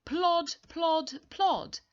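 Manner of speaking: high, loud, sing-song plod plod plod; heavy plodding. Acted. Teacher reading plodding feet to the class, loud and high. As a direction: stamp each plod like tired feet. Reading sound words to children.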